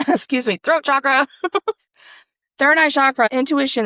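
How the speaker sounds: bubbly laughter breaking in; laughing. Real, not acted. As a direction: laugh out the card names. Laughing while reading cards aloud.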